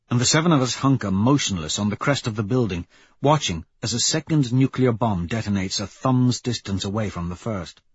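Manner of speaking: ordinary delivery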